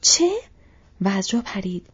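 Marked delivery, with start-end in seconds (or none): 0.0-0.3 high, loud exclamation; sudden high cry of surprise; startled shout. A narrator's reading. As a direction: shout it in disbelief. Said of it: "تام بابادیل فریاد کشید".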